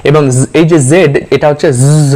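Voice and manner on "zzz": জ said as a long buzzing Z; buzzing Z sound. Shown as a demonstration. Teacher demonstrating the letter zed, জ as buzzing Z. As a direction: hold a buzzing English Z on জ. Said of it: "এই বাজিং সাউন্ডের জেড".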